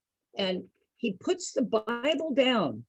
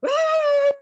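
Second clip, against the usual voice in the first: high, loud hammed-up cry; mock preacher cry; 7 dB louder than usual. Imitating the host's final Bible-thumping cry. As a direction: belt out one last Ah like a preacher. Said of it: "he got tired of going".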